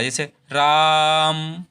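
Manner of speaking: राम with the आ held very long and slow; drawn-out raam. Shown as a demonstration. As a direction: stretch the आ in राम to triple length. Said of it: "रा को हमने तीन गुनी देर में बोला".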